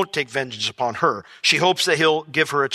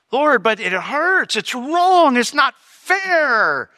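slow, high pleading whine; whiny complaint; 7 semitones higher, much slower than usual. Retold or imitated. Preacher whining to the Lord that it isn't fair. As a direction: drag out the hurt.

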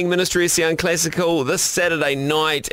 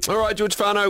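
normal talking voice

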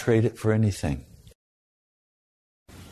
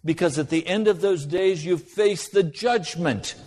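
raised, urgent pulpit voice, pitch higher; impassioned preaching; 6 semitones higher than usual. Acted. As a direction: preach it with rising fervor, higher. Preaching builds to warning.

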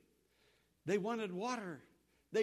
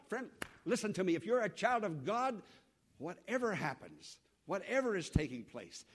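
ordinary delivery

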